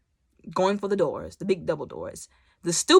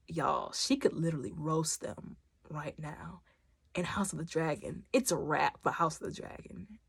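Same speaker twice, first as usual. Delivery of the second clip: whispered; 3 semitones lower, 10 dB quieter than usual. Real, not acted. Vlogger whispering the shock to viewers. Hushed aside.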